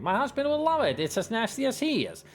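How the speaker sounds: high-pitched, gushing storybook lady; crying out eagerly. A narrator's reading. Lady tells clerk about her husband, excited high voice. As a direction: keep the lady's excitement up high. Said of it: "cries the lady".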